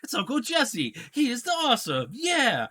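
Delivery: high, eager little guy voice; excited Brad. Retold or imitated. Brad gushing to Bob Saget about meeting Stamos, high and eager. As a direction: gush like a thrilled fan. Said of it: "Brad's like".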